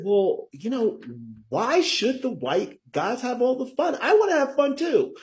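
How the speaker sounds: high-pitched, playful indignant plea; eager protest. Retold or imitated. Guest quotes his reaction on reading the book, high eager protest. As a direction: pitch it way up, wanting in on it. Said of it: "when I read this book, I said".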